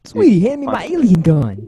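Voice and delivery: loud, punchy tough-guy movie line; Mark Wahlberg impression. Friend shows how Mark Wahlberg says it, loud tough guy. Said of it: "Wahlberg impression".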